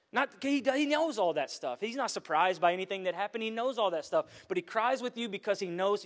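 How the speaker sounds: full-force sermon delivery; preaching. Acted. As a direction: build the point like a pulpit climax.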